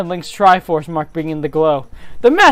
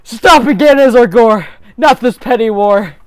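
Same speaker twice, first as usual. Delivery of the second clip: loud command; 4 semitones higher, 7 dB louder than usual. Acted. In-character command.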